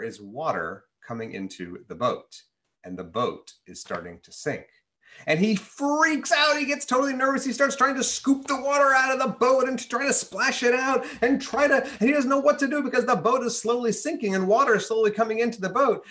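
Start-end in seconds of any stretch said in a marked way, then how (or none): 5.3-16.0 high, excited storytelling of the panic; much higher, rushed, breathless narrator; panicky narration. A narrator's reading. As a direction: tell it like you're starting to panic yourself.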